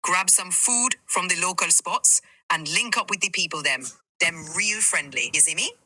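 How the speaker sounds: conversational Jamaican accent; easy Jamaican lilt; Jamaican patois. Shown as a demonstration. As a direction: give friendly tips in Jamaican patois. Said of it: "tell us in Jamaican, in patois".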